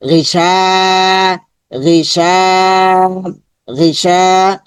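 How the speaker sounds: "শা" held long after a short "গি"; slow drill, long level "শা" in "গিশা"; lengthened vowel. Shown as a demonstration. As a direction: stretch the end of "গিশা" each time. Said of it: "শাটা লম্বা হবে".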